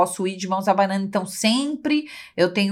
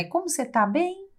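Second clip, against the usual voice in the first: serene voice; 9 semitones higher, 4 dB quieter than usual. Retold or imitated. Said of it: "a fala é sempre essa".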